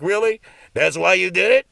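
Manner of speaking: God scoffing at a lame excuse; sarcastic, unimpressed God; incredulous God. Retold or imitated. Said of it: "No, God be like".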